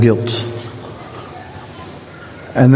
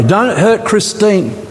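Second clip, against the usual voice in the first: building preaching; 7 semitones higher than usual. Acted. Preacher naming the congregation, building in pitch. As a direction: build with each name. Preacher escalates names of people.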